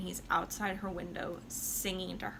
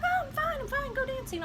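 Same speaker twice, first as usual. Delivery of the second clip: much higher, chirpy brush-off; falsely cheery. Retold or imitated. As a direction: sing it up high to wave them away. Violetta hides her weakness.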